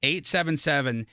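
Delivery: ordinary talk, nothing marked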